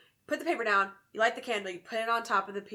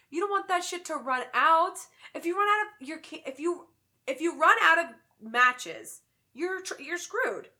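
urgent warning; 7 semitones higher, 6 dB louder than usual. Real, not acted. Urgent warning about matches.

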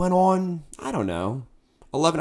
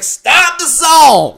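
long, high, full-force yell; commanding shout; 13 semitones higher, 11 dB louder, much slower than usual. Acted. Singer halting his band mid-solo, drawn-out furious shout. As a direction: bellow it slowly to halt everything.

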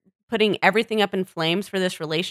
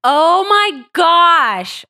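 slow, loud, exasperated oh my gosh; drawn-out exclamation; 8 semitones higher, 8 dB louder, much slower than usual. Real, not acted. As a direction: stretch it out in disbelief. Outraged at Tom's remark.

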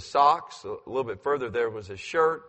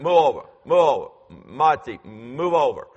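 imitating son; 7 dB louder than usual. Son shoving preacher, imitated.

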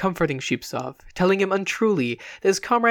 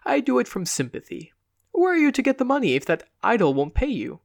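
put-on higher, fussing, kindly old healer; kindly barber-healer; 6 semitones higher than usual. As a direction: coax him like a gentle quack doctor. Rigoletto voiced at length.

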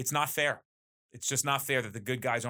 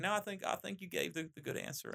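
soft, lightly imitated Southern accent; 6 semitones higher, 6 dB quieter than usual. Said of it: "you know, Southern accent".

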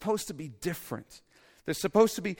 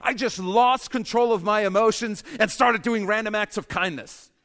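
higher, louder, mock-cheerful quote; mock absurd quote; 7 semitones higher, 8 dB louder than usual. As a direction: ham up the absurd story. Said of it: "stories that are like".

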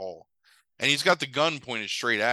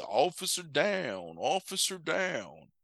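drawn-out, very slow officer down; really slow; 4 semitones higher, 5 dB quieter, much slower than usual. Retold or imitated. Retelling the ending, the wounded man speaks really slowly. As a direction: stretch each word out slowly. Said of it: "this man talking in this really slow".

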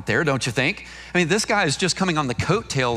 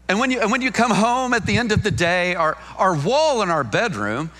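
ordinary delivery

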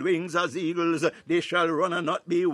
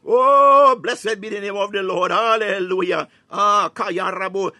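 ecstatic praise; 3 semitones higher, 7 dB louder than usual. Real, not acted. Preacher to congregation, erupting in loud ecstatic praise. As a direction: burst out in rapturous worship.

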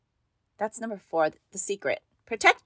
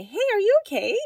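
much higher gentle voice, showing the caring ask; gentle check-in; 13 semitones higher, 4 dB louder, faster than usual. Shown as a demonstration. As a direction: ask lightly and kindly, as a worried mom. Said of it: "Ask if everything is okay".

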